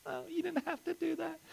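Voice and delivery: high, hushed, acted tearful thanks; tearful gratitude. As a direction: play choked up by a generous gift. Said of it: "They're crying".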